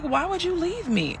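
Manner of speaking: high-pitched plaintive question; retold hurt protest. Pregnant woman on phone to partner, high hurt protest. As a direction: plead it high, in disbelief.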